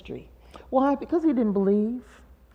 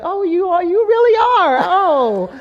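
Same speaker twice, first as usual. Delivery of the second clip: much higher and louder, gushing; mock realization; 9 semitones higher, 9 dB louder than usual. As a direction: ham up the stunned sibling finally getting it. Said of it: "all of a sudden you're like".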